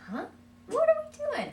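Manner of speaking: very high, loud "What are we doing?"; child surprised by a sock on his hand; puzzled child. Pretend. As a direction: play the confused toddler. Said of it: "be like".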